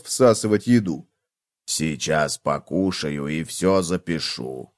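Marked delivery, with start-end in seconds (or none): 1.7-4.7 low, slow, soft bug voice; a slow, low-down cockroach. A narrator's reading. Cockroach talks while eating.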